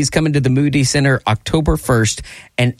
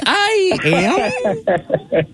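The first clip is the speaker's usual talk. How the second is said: high, wavering imitation of unsure answer; mock their hesitant answer in a high, questioning voice; unconfident mock; 12 semitones higher than usual. Host teasing the caller's shaky answer, mock unconfident. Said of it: "You didn't sound very confident".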